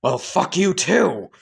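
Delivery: snappy comeback. Acted. Doma trading insults.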